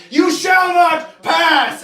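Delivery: slow, high, loud booming shout; hammed-up Gandalf bellow. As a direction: roar it like a wizard blocking a bridge. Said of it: "Gandalf was like".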